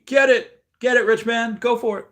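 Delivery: high, loud hype cheer; excited cheering. Real, not acted. Host cheering a chat member's big buy, excited and loud. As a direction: cheer him on like a fan in the stands.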